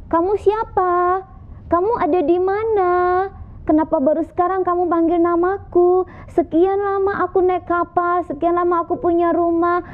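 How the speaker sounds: put-on high, insistent young man calling out; young man demanding. Young man calls to the unseen voice, high and insistent. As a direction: demand it high, as if searching the dark.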